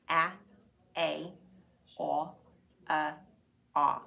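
"A," "A," "aw," "uh," "aw." spoken one by one as separate vowel sounds; teacher recap of each vowel sound of A; five A sounds. Shown as a demonstration. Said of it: "those are the five ways we can read A".

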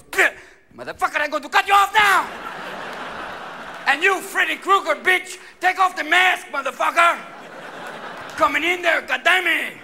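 high, loud hammed-up tirade; furious threat. Spanish hockey player menacing the masked goalie, furious. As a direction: threaten them at the top of your voice.

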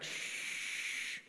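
voiceless shush; hushing shh. Retold or imitated. Dad hushing his son so he won't scare the fish, voiceless shush. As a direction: hush a fidgety kid by the water.

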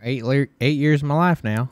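Southern accent. Shown as a demonstration. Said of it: "I can do a decent one".

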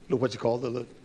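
ordinary talk

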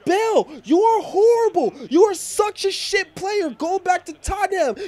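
very high, loud hammed-up fury; outraged yelling. Fan berating Bale for not passing to Ronaldo, outraged yelling. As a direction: shriek in disbelief at a useless teammate.

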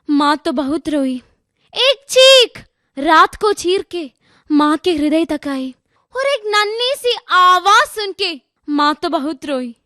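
dramatic recital with high, drawn-out openings; poem recitation. Acted. Student reciting a poem on stage, dramatic recitation. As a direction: declaim the verse, punching each opening phrase.

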